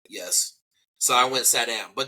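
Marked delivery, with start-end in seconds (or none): 0.1-0.6 whispered, sheepish yes; meek whispered yes. Retold or imitated. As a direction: breathe it out like a scolded student. Meek reply to professor.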